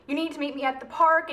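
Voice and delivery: normal talking voice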